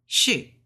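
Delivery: "shi" with a falling Mandarin fourth tone. Shown as a demonstration. Teaching learners the tones, "shi" in falling fourth tone. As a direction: drop the pitch sharply on "shi". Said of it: "Fourth tone".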